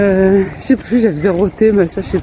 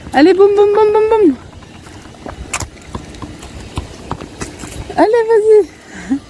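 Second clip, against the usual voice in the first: excited cheering; 11 semitones higher than usual. Real, not acted. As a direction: egg her on to kick. Cheering child in ball game.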